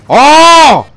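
loud, high exclamation of anger; shouting at another driver. Real, not acted. Player yells at the driver who messed up, loud and high. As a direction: yell it at the guy who wrecked you.

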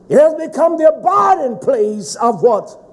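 loud, high, emphatic sermon delivery; full-force preaching. Acted. Preacher thundering at the congregation, full force.

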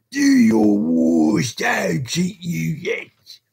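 high, slow, drawn-out ruffian's yell; menacing shout that eases at the end; desperate defiant cry. A narrator's reading. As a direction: hurl a defiant taunt at the crowd. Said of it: "cried the desperate ruffian".

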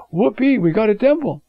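a light imitation of kids cheering; excited youngsters. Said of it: "the youngsters who never saw a temple are saying".

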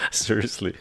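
ordinary talk, nothing marked

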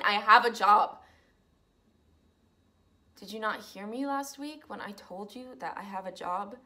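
soft, hurt and close to tears; wounded plea. Acted. To her roommates, a hurt, subdued protest. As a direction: hold back tears while defending yourself.

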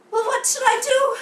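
high, loud, fast plea; overacted frantic child; panicked young cub. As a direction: beg for an answer in a rush. Acted cub begs villain for advice.

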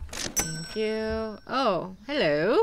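swooping, drawn-out mock-British greeting; British accent. Shopkeeper greeting returning English customer, playful British accent. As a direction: play at an English hello with a rising lilt. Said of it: "We're back in England".

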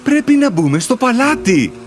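ordinary delivery, nothing marked

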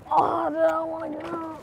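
normal talking voice

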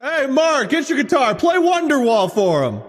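high mock call to the kid; encouraging parent. Parent calling the kid to play guitar for guests, high and eager. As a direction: holler it out, stretching the song request. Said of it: "encouraging campfire guitar guy".

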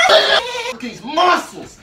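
high, slow, laughing brag; playful boastful crow; mock show-off. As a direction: flex and crow about it. Showing off muscles, laughing.

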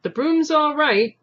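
high, loud and slow call; shout. A narrator's reading. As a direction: shout it down the car to the driver. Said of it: "the ticket collector shouted to the driver".

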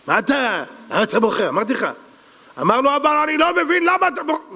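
high voice, climbing further; exasperated exchange. Acted. Advisor asking the king why he cries, insistent. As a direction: push the question up into a high insistent cry.

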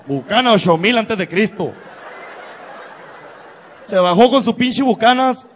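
ordinary talk, nothing marked

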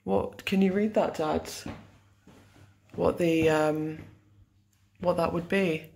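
ordinary delivery

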